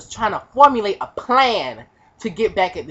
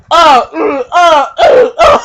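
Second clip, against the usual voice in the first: loud, high choppy grunts; grunts under punches; 7 semitones higher, 8 dB louder, slower than usual. Retold or imitated. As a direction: grunt with each hit. Being punched repeatedly.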